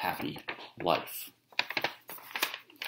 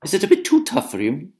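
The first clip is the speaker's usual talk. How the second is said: higher, sly taunt on "too"; teasing question; 11 semitones higher, 3 dB louder, faster than usual. Acted. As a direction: needle him about "too tough". Una teasing.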